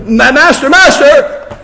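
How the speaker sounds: high, stammering mock panic; panicked stutter. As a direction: stutter out the name in a mock fright. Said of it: "sometimes they might stutter".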